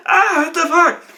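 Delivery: high, loud yelp of panic; mock screaming. Game master acting the Decker with alarm in his brain, mock screaming. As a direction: cry out over an imagined alarm. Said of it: "alarm screaming in his brain".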